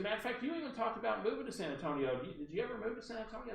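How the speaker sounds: put-on fast, high-pitched caller's accent; Barbara Delano. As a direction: rattle it off high in her accent. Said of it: "I have to use her accent".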